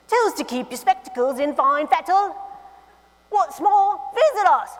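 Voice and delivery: hammed-up British accent. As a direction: read it like a proud London shopkeeper, in a British accent. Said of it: "I should do this in a British accent".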